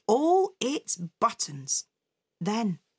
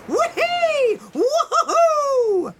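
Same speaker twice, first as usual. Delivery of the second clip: loud, very high squealed whoops; joyful whooping; 7 dB louder, much slower than usual. A narrator's reading. Mother and son dancing on the beach, whooping joyfully. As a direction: whoop with glee, long and high.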